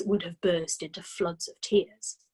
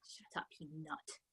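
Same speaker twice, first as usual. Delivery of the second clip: whispered, much softer; 5 semitones lower, 19 dB quieter than usual. A narrator's reading. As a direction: hiss it under your breath. Hiding the parrot from parents.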